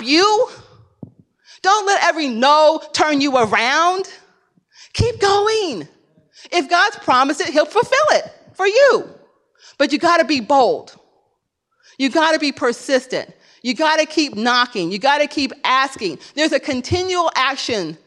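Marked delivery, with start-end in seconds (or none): none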